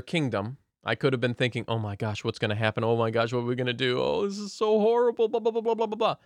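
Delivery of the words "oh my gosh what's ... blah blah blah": mock panic. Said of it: "panic, and so on".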